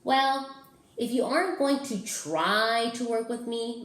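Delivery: loud, high whiny monster; monster whining. Acted. Mac sulks at the picky child, loud and whiny. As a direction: whine like a put-out monster. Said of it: "Mac whined".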